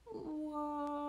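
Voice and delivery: long, soft, drawn-out whoa; soft held wail of a tumble; falling "whoa". A narrator's reading. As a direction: sustain it like a distant cry while falling. Squirrels go over the edge.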